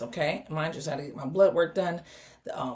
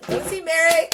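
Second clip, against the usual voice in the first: high, loud fan cheer; thrilled, pitched well up; excited; 11 semitones higher, 6 dB louder than usual. Real, not acted. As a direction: call it out giddily. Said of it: "I'm so excited".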